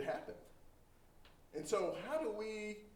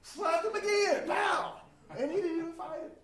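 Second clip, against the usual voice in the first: excited retelling; 8 dB louder than usual. A narrator's reading. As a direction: belt out the slap like the climax of the story. Retelling the second slap.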